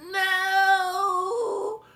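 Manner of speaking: high, loud furious no; furious shout. A narrator's reading. Jack Frost shrieks no in fury. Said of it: "in fury and said".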